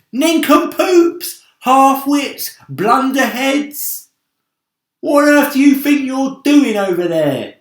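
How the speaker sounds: high, full-throated yell; yelling insults. Acted. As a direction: bellow it across the sky at them. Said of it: "yelling ... as loud as he could".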